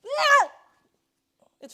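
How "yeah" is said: sudden loud, high cry of victory; triumphant shout. Real, not acted. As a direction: yell it out as you finally land the hit. Triumph swatting the mosquito.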